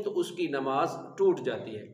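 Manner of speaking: ordinary talk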